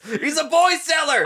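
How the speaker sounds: high-pitched, comic exclamation; mock outcry. Co-host reacting to a villain buying a boy, mock outcry. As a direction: cry it out in mock scandal.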